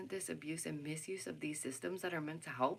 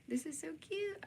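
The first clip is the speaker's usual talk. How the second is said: delighted coo; 10 semitones higher than usual. Real, not acted. Showing off her craft project.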